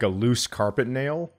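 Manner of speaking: ordinary talk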